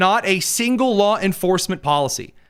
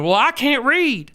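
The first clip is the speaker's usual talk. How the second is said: mock dim-witted robber; 8 semitones higher than usual. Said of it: "and you're".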